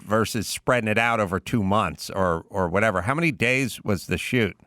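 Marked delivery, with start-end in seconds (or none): none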